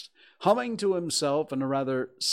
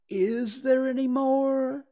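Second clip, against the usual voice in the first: high, loud eager question; quick, eager; 6 dB louder, slower than usual. A narrator's reading. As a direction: pounce on the question, pitched high. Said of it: "said Pooh quickly".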